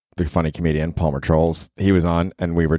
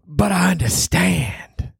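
high, very slow knowing drawl; Chris Rock bit; 10 semitones higher, much slower than usual. Retold or imitated. Comedian quoting a famous punchline, in a slow knowing drawl. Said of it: "Saying "I understand" in that tone of voice".

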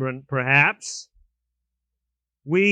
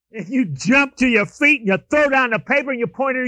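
ordinary delivery

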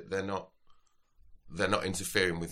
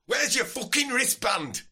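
put-on very high, loud, slow Yorkshire drunk; hammed-up sozzled Yorkshire lad; drunk Yorkshireman; 7 dB louder, slower than usual. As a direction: drunk Yorkshire festival-goer demanding a wristband. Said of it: "determined slasher from Yorkshire".

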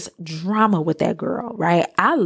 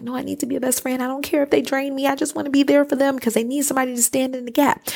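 lightly higher, eager people-pleaser voice; old eager me; 7 semitones higher, faster than usual. Retold or imitated. Recalling her old self to listeners, mildly eager voice. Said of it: "old me, used to be like".

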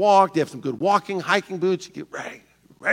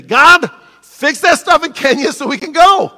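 high, loud, slow pleading; whiny demand; 10 semitones higher, 10 dB louder, slower than usual. Retold or imitated. Shallow faith demanding God fix Kenya, high, loud and slow. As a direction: beg God like a demanding child. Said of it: "The shallow faith is gonna say".

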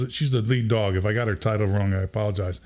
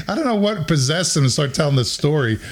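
ordinary talk, nothing marked

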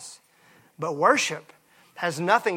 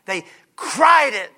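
cried drawn out, slower and louder; stress on cried; 4 semitones higher, 5 dB louder, slower than usual. Shown as a demonstration. Preacher stressing to the church that they cried it, slow and emphatic. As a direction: lean hard on "cried". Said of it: "They didn't just say it".

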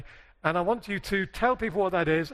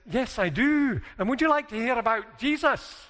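put-on higher-pitched Glasgow accent; 4 semitones higher than usual. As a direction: do an eager Glaswegian, imitating him. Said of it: "should do my Glasgow accent".

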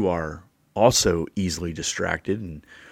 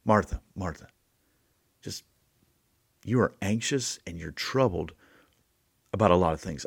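soft, gentle reassurance; Jesus gently calming an anxious Martha; 2 semitones lower, 6 dB quieter than usual. Shown as a demonstration. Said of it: "two, to calm her down".

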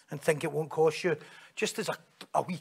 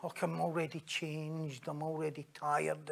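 very soft, droning reluctance; hushed, listless complaint; weary excuse; 2 semitones lower, 10 dB quieter than usual. Retold or imitated. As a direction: mumble it as if too tired to get up. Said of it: "she's just like".

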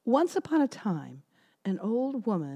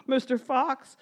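gingerbread man voice; 6 semitones higher than usual. Acted. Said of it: "he said".

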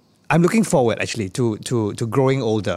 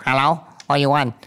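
rude storeman. Retold or imitated. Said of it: "he'll go".

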